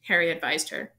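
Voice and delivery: ordinary delivery, nothing marked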